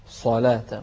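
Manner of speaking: صلاة with a heavy s, then a thin "laa"; light lam. Shown as a demonstration. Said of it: "ما تقول الصلاة".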